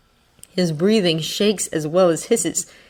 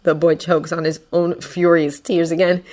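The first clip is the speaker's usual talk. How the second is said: ordinary delivery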